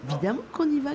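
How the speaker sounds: put-on high, eager child's call; a boy calling out keenly to his sister; child's eager cry. A narrator's reading. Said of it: "cria Bruno".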